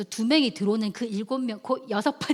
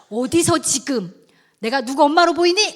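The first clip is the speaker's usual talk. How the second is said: loud, higher-pitched telling-off; stern scolding; 5 semitones higher, 9 dB louder than usual. Retold or imitated. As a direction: rebuke a pupil, rising in force. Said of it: "제가 책망을 하겠습니다".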